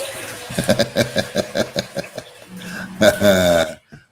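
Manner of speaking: long rolling laugh that swells at the end; long hearty laugh. Real, not acted. Host amused his show will derail, long hearty laugh. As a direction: crack yourself up, laughing on and on.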